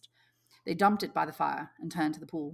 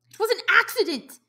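high and louder, insisting; defensive boy; 8 dB louder than usual. Acted. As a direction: insist it was nothing. Piggy voiced high.

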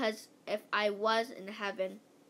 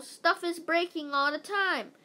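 higher-pitched, mildly raised call; yelling down; 6 semitones higher, 3 dB louder than usual. A narrator's reading. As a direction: yell an explanation down a hole. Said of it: "yelled into the hole".

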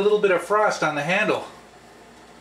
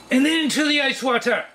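higher and fast, a bouncy little announcement; sing-song announcing; 7 semitones higher, faster than usual. Pretend. Vlogger rehearsing the plunge into ice water, higher and fast. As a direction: chirp it out like a cheerful cue. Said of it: "sing-song run-through of the plan".

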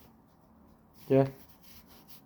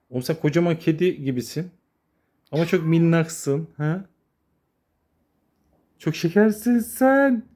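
cooing to kittens; 5 semitones higher than usual. Real, not acted. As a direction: melt over the tiny kitten.

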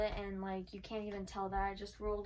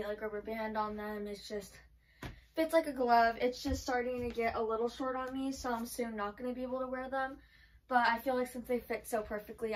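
ordinary talk

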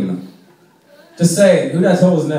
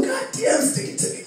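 mock lover; 6 dB quieter than usual. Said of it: "you're just like".

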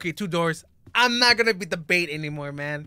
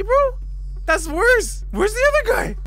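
scared; 8 dB louder than usual. Real, not acted. Alone with one teammate, alarmed.

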